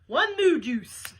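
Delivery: slow, loud order call; drawn-out waitress holler. Acted. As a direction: holler it slowly to the cook. Waitress calls order to kitchen.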